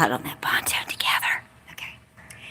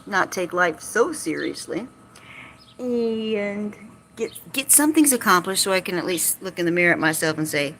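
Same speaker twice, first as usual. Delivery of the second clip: ordinary delivery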